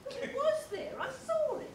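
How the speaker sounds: high-pitched, agitated pleading; frantic insistence. Acted. Woman begs the others to believe her sighting, frantic insistence. Insists she saw the dog.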